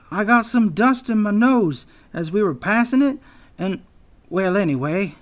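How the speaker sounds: high, loud character voice; anxious anteater. A narrator's reading. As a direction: explain nervously, pitched well up.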